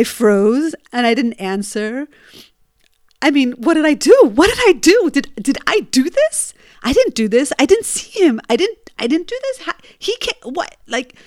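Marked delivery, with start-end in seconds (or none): 3.2-11.2 higher, rising frantic questions; a mind racing in panic; panicked inner voice. Retold or imitated. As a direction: spiral into disbelief. Panicked after hitting a boy.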